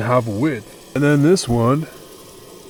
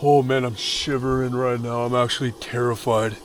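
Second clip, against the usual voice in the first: ordinary talk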